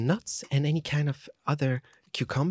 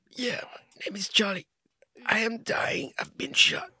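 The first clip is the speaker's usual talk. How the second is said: overacted death scene; 6 semitones higher than usual. Bird shot, dying scene.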